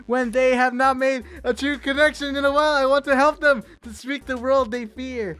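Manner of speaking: heartfelt storyteller voice; earnest poem reading. A narrator's reading. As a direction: plead gently, like a caring spirit. Reads poem aloud dramatically.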